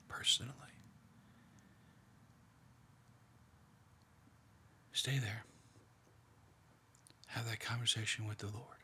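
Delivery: hushed, half-whispered meditation, leaving long pauses; guided meditation. Acted. Leader guiding a prayerful meditation, in a hushed voice. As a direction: draw listeners quietly into the scene.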